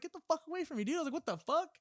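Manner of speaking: high-pitched mock alarm, not shouted; retold indignant protest. Storyteller to homeless man grabbing at him, mock indignant. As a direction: act out warding someone off.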